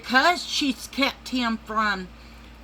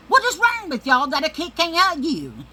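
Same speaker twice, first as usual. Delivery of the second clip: louder, higher mock yell at the relatives; retold yell; 8 semitones higher, 5 dB louder than usual. Retelling how she scolded the family, loud high yell. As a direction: repeat the question as a high, loud demand. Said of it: "I said".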